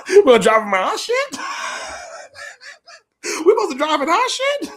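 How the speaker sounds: words breaking into high laughter; real laughter, high and cracking; laughing. Real, not acted. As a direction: laugh through it, can't keep a straight face. Laughing at their own plan.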